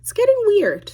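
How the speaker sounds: baffled side-eye at all the emotion; incredulous inner voice. Retold or imitated. Inner reaction to crying woman.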